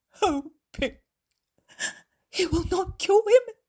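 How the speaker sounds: high, sobbing lament, slow in places; weeping. Acted. Desperate mother laments her dying son, sobbing. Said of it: "The woman begins to weep".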